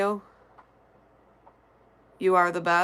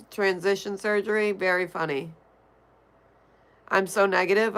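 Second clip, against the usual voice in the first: normal talking voice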